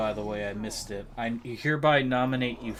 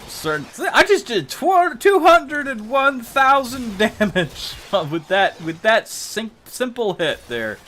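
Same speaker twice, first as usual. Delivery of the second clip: excited shout; 11 semitones higher, 8 dB louder, slower than usual. Real, not acted. Huge damage number in game.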